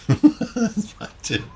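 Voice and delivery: high laughing chuckle. Real, not acted. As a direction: laugh it out, slowly. Laughing at own joke.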